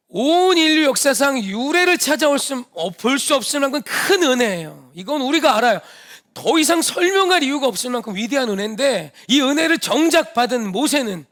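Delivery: fervent sermon climax; full-force preaching. Acted. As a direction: preach it with fire, rising to a climax.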